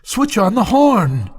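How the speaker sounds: high, pleading command; urgent cry. A narrator's reading. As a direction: call out in panic to switch it on. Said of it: "I cried".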